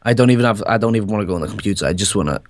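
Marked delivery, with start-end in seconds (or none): none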